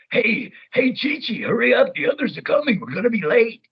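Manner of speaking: high, urgent wheezy character call; Ox King asthma voice. Shown as a demonstration. Ox King hurrying Chi-Chi along, high asthmatic character voice. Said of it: "kinda sounds like he has a little bit of asthma".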